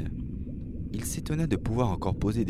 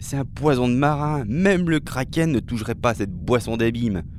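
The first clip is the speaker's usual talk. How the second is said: loud, higher-pitched reproach; raised voice, scolding the drinker; exclaimed warning; 4 semitones higher, 5 dB louder than usual. Acted. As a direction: warn emphatically about a dreadful drink. Mermaid warns about the drink.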